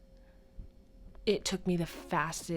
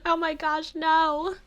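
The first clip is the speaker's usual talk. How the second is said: put-on kid whining no; 10 semitones higher, 4 dB louder than usual. Child refuses to read more.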